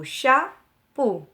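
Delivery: "chapeau" very slowly, split cha, peau; syllable by syllable. Shown as a demonstration. Teacher sets children the puzzle word, syllable by syllable. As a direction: stretch "chapeau" into two separated syllables. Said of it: "composé de deux syllabes".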